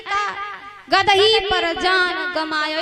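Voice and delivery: का alone, pause, then गदही as one word; slow verse broken before गदही; split as का, गदही. Shown as a demonstration. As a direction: say "का", stop, then slowly "गदही पर". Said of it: "उसने क्या लिखा".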